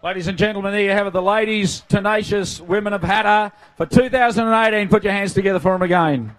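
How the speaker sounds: booming MC crowd announcement; rally the crowd like a ringmaster; grand entrance announcement. Acted.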